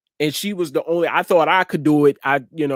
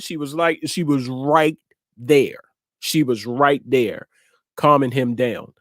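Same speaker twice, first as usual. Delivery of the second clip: ordinary talk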